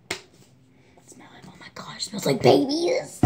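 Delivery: hushed whisper rising to a loud delighted one; whisper, then burst out about babies; gushing whisper. Real, not acted. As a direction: secret soap-sniffing whisper. Gushing over baby soap.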